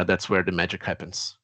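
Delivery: ordinary delivery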